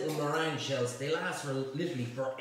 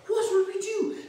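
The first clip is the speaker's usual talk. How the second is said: very high, loud mock-posh squeal; posh boys panicking, shrill squeal; panicked squeal; 8 dB louder than usual. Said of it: "So they go".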